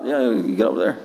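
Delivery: deep, low growl of a huge inmate; big prisoner's voice. Retold or imitated. Pastor retelling the jail visit, deep prisoner voice. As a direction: sound like the giant behind the door.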